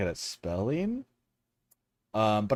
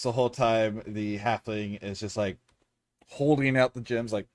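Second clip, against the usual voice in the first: ordinary delivery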